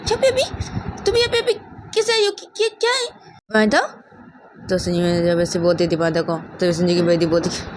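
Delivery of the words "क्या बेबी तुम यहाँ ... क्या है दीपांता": very high, stammered disbelief; startled questioning. Acted. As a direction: stumble over the question in a shocked high pitch. Mother startled by unexpected visitor. (चौंककर ऊँची, अटकती आवाज़ में सवाल)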